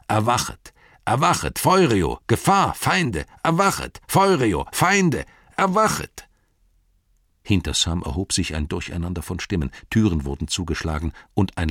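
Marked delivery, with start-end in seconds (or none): 0.1-6.3 high, ringing cries that climb in pitch; alarm call. A narrator's reading. Horn call of Bockland rousing everyone against enemies, high alarm cries. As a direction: sound the alarm, each word a high separate cry. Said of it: "ließ der Hornruf von Bockland die Luft erzittern".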